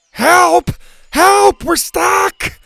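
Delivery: very high, loud cries for help; panicked shouting. A narrator's reading. Bo stranded up the tree, shouting frantically. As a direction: yell far out in panic. Stranded boy calls for rescue.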